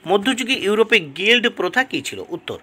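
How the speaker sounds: ordinary talk, nothing marked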